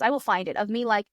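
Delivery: usual speaking voice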